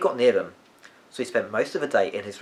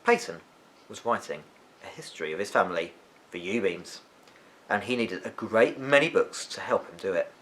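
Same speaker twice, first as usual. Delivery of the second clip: ordinary delivery, nothing marked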